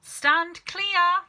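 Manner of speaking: high, loud call to back off; warning call. Acted. Father warning everyone back, high and loud. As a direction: shout the warning.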